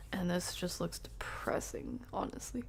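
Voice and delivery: soft whispered aside. Real, not acted. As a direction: whisper it honestly.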